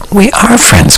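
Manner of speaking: insist, leaving no doubt; firm reassurance to her friend; emphatic. A narrator's reading. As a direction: stress on "are" in "We are friends", pitched high. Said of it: "Gwen said emphatically".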